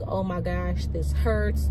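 ordinary delivery

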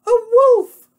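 very high, loud squeal; pig's alarmed cry. Acted. Pig names the danger on the phone, squealed alarm. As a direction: shriek it as a frightened piggy. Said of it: "Pig cried".

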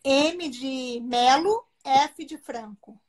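spelled out slowly, letter by letter; spelling a handle slowly for listeners. Real, not acted. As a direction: dictate each letter slowly and clearly.

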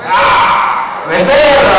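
explosive full-force yell; very loud outburst of rage; furious shout. Acted. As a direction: bellow at your cellmate. Inmates' fight breaks out in cell.

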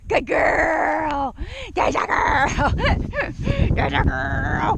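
high, drawn-out gushing praise; excited dog praise. Real, not acted. Trainer praising Daisy for coming, high and delighted. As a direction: gush loudly over your dog.

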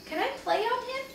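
put-on high-pitched child's request; small boy asking permission; eager little boy. A narrator's reading. Little boy asks to play.

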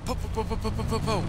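high-pitched popping gunfire imitation; mock machine-gun noise. Player faking a drive-by from the car, machine-gun noise. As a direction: fire off rapid pretend gunshots. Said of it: "I don't have a machine gun".

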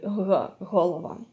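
a drawn "huh", then голова as "holova"; voiced h. Shown as a demonstration. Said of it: "you're using your voice".

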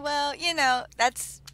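ordinary talk

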